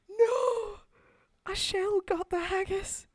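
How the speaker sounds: high, loud cry of horror in Scots; Scottish outcry. Acted. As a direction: wail it in dismay, Scottish accent. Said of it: "do any more Scottish".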